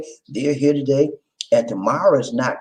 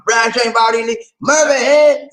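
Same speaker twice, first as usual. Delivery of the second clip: excited shout-out; 8 semitones higher, 6 dB louder, slower than usual. Real, not acted. Shouting out viewers.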